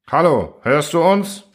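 high, drawn-out hail; calling out. A narrator's reading. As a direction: call to someone behind a wall. Calling out to the machine. (laut und gedehnt rufend)

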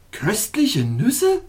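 slow, puzzled echo; wondering. A narrator's reading. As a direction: repeat it in disbelief. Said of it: "in einem Ton der Verwunderung".

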